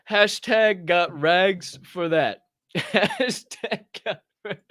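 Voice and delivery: slow, stretched chant; slow slogan drawl. Acted. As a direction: drag the slogan out. Slogan drawn out.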